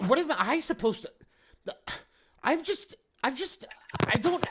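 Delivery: a mayor losing his composure, voice climbing high; flustered high panic. Acted. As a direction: stammer in rising panic. He seems very flustered by all of this.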